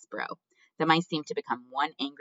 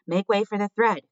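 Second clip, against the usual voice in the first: high, loud call; cried out; 8 semitones higher, 8 dB louder than usual. A narrator's reading. Mouse clearing the crowd for the thread, crying out. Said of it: "cried a mouse".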